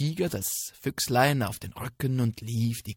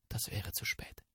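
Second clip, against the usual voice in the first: suspense whisper; 10 dB quieter than usual. A narrator's reading. Drohende Gefahr.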